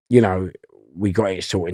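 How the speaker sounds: normal talking voice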